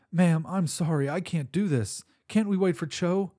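pleading. Acted. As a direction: beg out of fear. Panicked cook refusing to fly.

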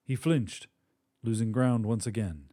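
ordinary delivery, nothing marked

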